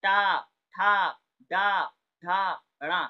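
loud slow टा ठा डा धा, tongue curled back; retroflex sounds demo. Shown as a demonstration. Teacher drills retroflex consonants, loud and slow. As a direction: sound out टा ठा डा धा slowly. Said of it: "इनसे बोली जाती है".

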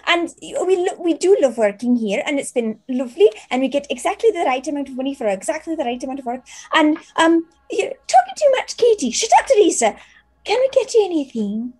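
put-on a giddy teenage maid insisting; excited teen maid. GM voicing maid Teresa to her friend, high and giddy. GM voices both maids.

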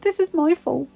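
put-on high, faltering girlish confession; apologetic teenage girl. A narrator's reading. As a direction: take the blame, stumbling at the start. Belle's voice, blaming herself.

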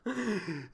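soft falling laugh. Real, not acted. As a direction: laugh quietly to yourself. Laughs alone, no words. (risa floja)

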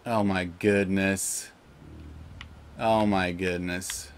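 slow, soft, low lament; dejected sigh. Real, not acted. Gamer grieving his dead pet, slow and soft. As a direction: mourn it quietly.

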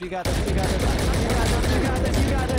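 loud, building self-encouragement; psyching myself up. Real, not acted. Psyching up during clutch.

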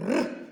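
«Р» as a loud rolled trill; isolated consonant. Shown as a demonstration. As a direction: roll a lone «Р», no word around it. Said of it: "перший звук який?". (розкотистий окремий звук «Р»)

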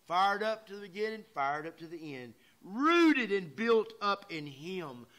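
loud rising pulpit call, slowing on the last line; preaching fervor. Acted. Preacher rallying his flock to stay fired up, fervent preaching. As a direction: lift the church up with it.

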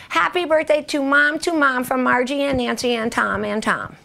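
put-on high sing-song little-kid reading; child's card recital. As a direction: recite it like a proud seven-year-old. Reads her childhood card aloud.

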